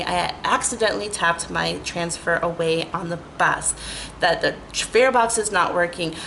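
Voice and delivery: normal talking voice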